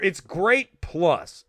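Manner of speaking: ordinary talk, nothing marked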